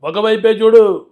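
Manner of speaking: slow, then building to a loud roaring threat; Balayya punch dialogue. Acted. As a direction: warn the villain, rising to a shout. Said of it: "ఆ dialogue".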